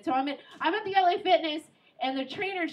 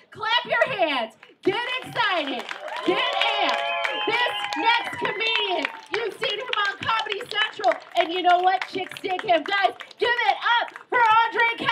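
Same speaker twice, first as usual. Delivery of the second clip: loud, higher crowd-hyping call; hype host; 6 semitones higher, 6 dB louder than usual. Acted. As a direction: pump up the room like an MC.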